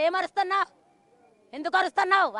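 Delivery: loud, high mock scolding; mimicking the old man's harsh shout; imitating an old man scolding. Widow imitating the old man yelling at her, loud. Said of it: "ఒక ముసలోడు అన్నాడు".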